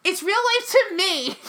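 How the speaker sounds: high, drawn-out upset wail; worked up. Real, not acted. Fan insisting to her co-host that the show matters, worked up. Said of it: "I'm getting really worked up".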